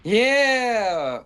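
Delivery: high, loud whoop; triumphant yeah; excited cheer. Real, not acted. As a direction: cheer the arriving ally. Cheering summoned ally.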